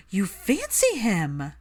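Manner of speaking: drawn-out delighted accusation; gasping delight. A narrator's reading. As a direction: catch her out with glee. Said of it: "Hermione gasped".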